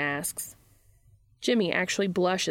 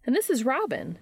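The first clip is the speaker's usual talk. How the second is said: high-pitched introducing voice; Charlie; 7 semitones higher than usual. A narrator's reading. Charlie presents Robin to the newcomer, high character voice.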